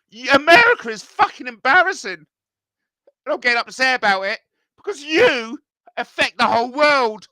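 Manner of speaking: high, loud indignant rant; getting upset. Real, not acted. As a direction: tear into America, fed up and loud. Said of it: "I'm getting too upset".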